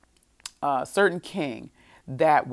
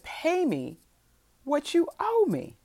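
pitched way up, mock-outraged demand; retold demand; 12 semitones higher than usual. Creditor grabbing his debtor by the throat, high-pitched demand. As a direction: demand it high, with a pause mid-sentence.

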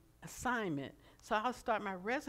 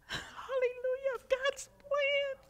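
crying; 4 dB louder, slower than usual. Real, not acted. Preacher breaks into tears.

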